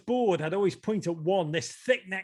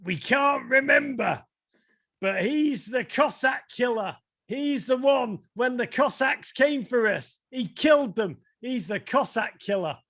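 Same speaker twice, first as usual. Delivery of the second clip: higher-pitched storytelling grandpa imitation; grandpa telling legend; 6 semitones higher than usual. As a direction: act out grandpa declaiming the family legend. Acting grandpa's proud tale.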